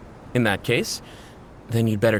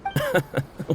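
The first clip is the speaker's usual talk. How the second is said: quick amused laugh; a brief chuckle at his friend; short laugh; 5 semitones higher, 4 dB quieter than usual. Real, not acted. Chuckling to back up his crewmate's words.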